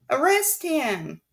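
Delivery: loud, slow call to arrest him; crowd shout. Acted. Audience member shouting at Olaf. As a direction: demand it from the crowd. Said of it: "a voice said from the audience".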